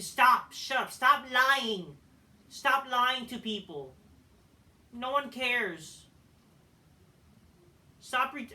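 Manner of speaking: real fed-up rebuke; exasperated outburst. Real, not acted. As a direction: cut in angrily, stretching out the words. Breaks off reading to berate the wrestler.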